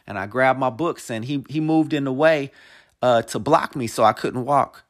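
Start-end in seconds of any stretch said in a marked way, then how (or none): none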